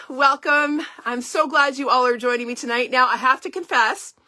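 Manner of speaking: normal talking voice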